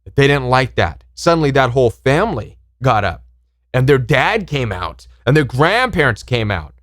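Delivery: usual speaking voice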